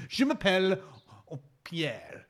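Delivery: laughing put-on French; mock French accent. Host introducing himself again, in mock French. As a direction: give the name in silly French.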